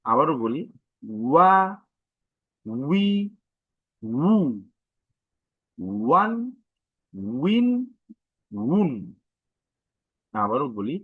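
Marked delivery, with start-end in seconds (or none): 1.2-8.9 ওয়া, উই, উ, ওয়ান, উইন, উন each apart, with a w glide; pushed w sound. Shown as a demonstration. Teacher repeats the syllable drill, pushing the w. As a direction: push each from ওয়া to উন, pitch rising. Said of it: "একটা force নিয়ে বের হচ্ছে".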